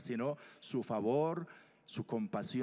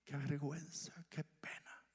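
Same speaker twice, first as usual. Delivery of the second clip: ashamed whisper; 3 semitones higher, 7 dB quieter than usual. Acted. Voicing the woman's shame.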